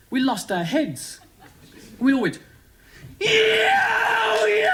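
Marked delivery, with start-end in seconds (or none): 3.2-4.7 big triumphant yell, pitched way up; loud high whoop of celebration; cheering yell. Retold or imitated. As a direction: roar it like a fan at a goal. Fans cheering a goal.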